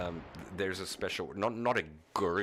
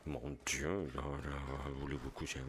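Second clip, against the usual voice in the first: softer, lower mock French purr; a quiet, lower put-on French phrase; 4 semitones lower, 5 dB quieter than usual. Streamer jokes to chat about French, soft mock French. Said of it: "the French are very".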